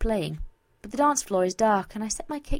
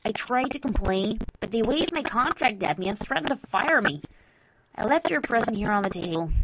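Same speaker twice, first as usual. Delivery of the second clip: louder, animated letter-writer's voice; lightly voiced Vinyl; 5 dB louder than usual. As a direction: read the note in the sender's voice.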